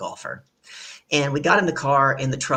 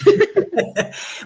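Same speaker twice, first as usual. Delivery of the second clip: burst of laughter; 8 dB louder than usual. Real, not acted. Laughing at shared joke.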